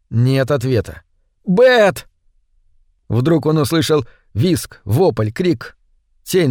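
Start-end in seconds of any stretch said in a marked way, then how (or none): 1.4-2.1 high, sharp frightened call; alarmed cry. A narrator's reading. Man calling Бет after no answer, alarmed cry.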